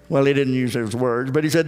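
ordinary delivery

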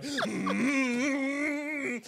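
long, high, swelling hum; ritual hum. Retold or imitated. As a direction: hum loudly as a pre-round ritual. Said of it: "This is what we did before".

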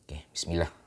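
soft whispered blessing before eating; whispering. Real, not acted. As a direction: breathe it out as a whisper.

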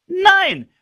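very high, loud "Nein"; emphatic no. Real, not acted. Preacher rejects passive Christians with a loud "Nein". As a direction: cut it off sharply.

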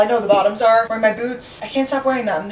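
ordinary talk

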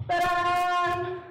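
playful loud reveal; ta-da fanfare. Acted. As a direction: announce the trick like a stage magician. Coach's magic reveal at the count.

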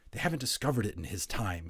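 usual speaking voice

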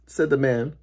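usual speaking voice

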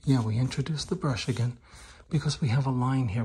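ordinary talk, nothing marked

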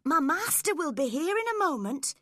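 loud, high-pitched alarm; urgent warning. A narrator's reading. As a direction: warn them urgently, voice jumping up. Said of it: "I warned them".

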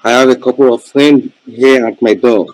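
loud, to carry down a bad phone line; calling out so she can hear him. Real, not acted. Caller explaining visitors at his door over a bad line, loud.

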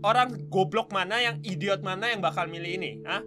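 scornful outburst at the screen; angry rant. Real, not acted. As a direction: rant in disbelief. Ranting at dialogue option.

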